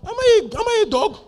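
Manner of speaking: high, loud offended shout; the giant can't believe the insult; furious Goliath. Retold or imitated. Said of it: "he was so angry".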